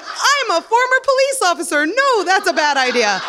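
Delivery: high, loud, laughing mock voice; wife's excuse. High, loud, laughing ex-cop wife turning down the coke. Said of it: "And my wife's like".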